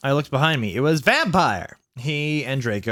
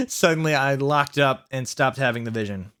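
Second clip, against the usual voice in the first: ordinary delivery, nothing marked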